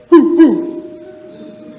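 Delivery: big high owl hoot; loud owl hoot. Shown as a demonstration.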